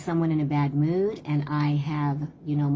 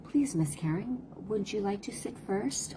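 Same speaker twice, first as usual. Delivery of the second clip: put-on high, soft whispered child voice; sweet little boy; 5 semitones higher, 7 dB quieter than usual. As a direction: voice a shy polite little boy, hushed. Little boy's polite request quoted.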